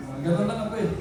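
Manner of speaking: normal talking voice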